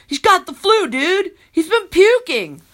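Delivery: irritable. A narrator's reading. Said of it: "in an irritable voice".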